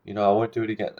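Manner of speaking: high, meek imitated apology; put-on sheepish sorry voice; mock apologetic. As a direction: imitate someone pleading for approval. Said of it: "they start to seek your approval".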